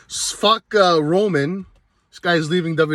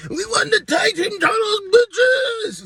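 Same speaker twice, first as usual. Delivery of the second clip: much higher screaming victory yell; gloating shout; slower than usual. Retold or imitated. Vlogger imitating the Usos celebrating their titles with a much higher gloating scream. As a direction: scream it like a cocky champion. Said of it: "you got the Usos like".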